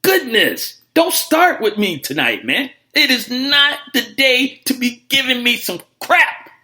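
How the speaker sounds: high, loud, drawn-out mock exasperation; exasperated rant. Real, not acted. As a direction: scold the caller with theatrical disbelief. Host exasperated at a literal-minded caller.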